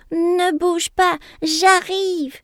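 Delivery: high, loud, slow call; shouting. A narrator's reading. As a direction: call out in alarm to a friend. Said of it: "crie Double Doux".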